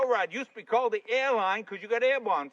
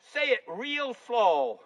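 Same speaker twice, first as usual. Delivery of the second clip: half-speed, demonstrating the slowness wanted; slow, spelled-out request; much slower than usual. Retold or imitated. Husband asking a local for help, drawn out slowly. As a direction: stretch each word right out.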